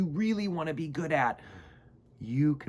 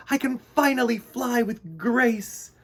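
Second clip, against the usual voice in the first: higher, louder delighted bee voice; joyful cry; 10 semitones higher, 6 dB louder than usual. Acted. Bee Mister Bumble crying out proudly after learning to fly. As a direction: burst out with happy pride. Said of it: "he cried".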